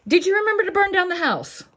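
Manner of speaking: high, loud, fast sly whisper of bad advice; mischievous inner voice. A little inner voice urging arson, high and loud. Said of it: "That's a voice you need to ignore".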